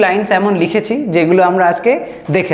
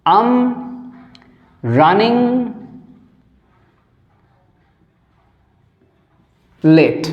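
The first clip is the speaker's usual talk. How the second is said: long pauses between each word; word-by-word dictation; much slower than usual. Shown as a demonstration. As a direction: dictate slowly as if students write. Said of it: "সেটা আমরা আজকে এখানে ব্যবহার করব".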